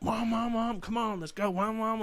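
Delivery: put-on high, pleading child calling out; whiny kid voice. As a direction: nag like an impatient kid. Pleading with slow rider ahead.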